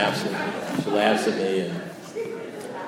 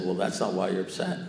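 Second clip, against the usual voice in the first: low, soft, understated correction; calm contradiction; 4 semitones lower, 7 dB quieter than usual. Retold or imitated. Sponsor answering the ranting caller, calm and quiet. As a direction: quietly let the air out of his rant.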